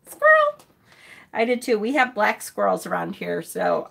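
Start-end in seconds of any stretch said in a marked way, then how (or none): 0.1-0.6 much higher and louder, a delighted squeal; excited squeal. Real, not acted. Streamer telling a viewer she saw a squirrel, an excited squeal. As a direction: squeal the word with glee.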